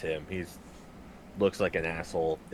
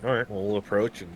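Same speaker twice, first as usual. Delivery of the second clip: ordinary talk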